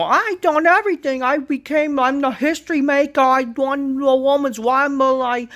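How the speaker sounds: high, loud mocking whine of a self-important wrestler; mocking Becky Lynch. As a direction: ham up her boastful promo in a high voice. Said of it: "Becky Lynch comes out how".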